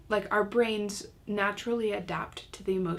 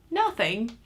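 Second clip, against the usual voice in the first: incredulous parent; 3 semitones higher, 5 dB louder than usual. Retold or imitated. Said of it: "I'm like".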